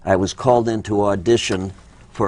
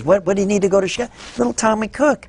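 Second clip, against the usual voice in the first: quick, much higher, lightly voiced Russian actress; a hint of the grand actress, rattled off fast; imitating Nazimova; 9 semitones higher, faster than usual. Great actress dismissing the director's Chicago worry, hurried and high. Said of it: "Nazimova says".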